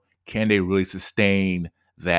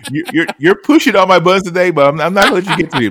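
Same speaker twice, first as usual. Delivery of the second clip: louder and higher, riled-up banter; excited; 8 semitones higher, 8 dB louder, faster than usual. Real, not acted. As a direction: fire back, fast and amused. Playfully riled by host's trolling.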